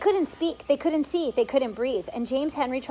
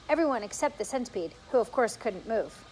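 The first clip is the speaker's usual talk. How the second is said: plain reading voice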